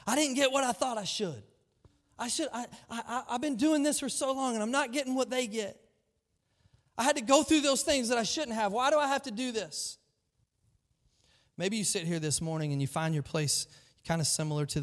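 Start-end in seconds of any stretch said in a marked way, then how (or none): 0.1-10.0 higher, aggrieved complaining voice; resentful Cain. Retold or imitated. Cain resenting God for what others got, high and aggrieved. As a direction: whine it like a bitter brother. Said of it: "resentment towards God".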